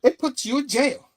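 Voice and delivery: loud, high angry comeback; angry retort. Retold or imitated. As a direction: fire it back at him. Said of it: "And Spidey's like".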